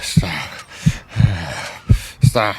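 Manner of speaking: anguished cry. Acted. Man in agony begging it to stop, loud cry. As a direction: cry out in pain.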